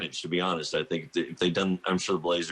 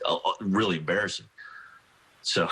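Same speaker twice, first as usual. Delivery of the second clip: ordinary delivery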